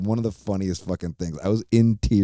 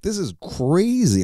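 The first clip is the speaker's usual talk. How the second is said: much higher, slower amazed exclaim; excited; 12 semitones higher, slower than usual. Retold or imitated. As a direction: sound amazed and thrilled. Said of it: "I'm like".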